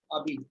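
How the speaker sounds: abite modelled alone as "a-beet"; silent H. Shown as a demonstration. Teacher repeats the word for a student, silent H. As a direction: give "abite" by itself, vowel first, no H. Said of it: "We pronounce it as".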